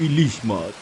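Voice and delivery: ordinary delivery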